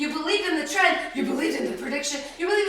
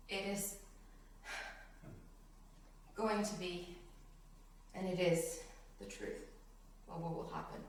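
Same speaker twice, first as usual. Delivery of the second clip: very soft, low, long pauses; hushed solemnity; 7 semitones lower, 17 dB quieter than usual. Real, not acted. Preacher telling what will happen, hushed and grave. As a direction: let it fall nearly to a whisper, solemnly.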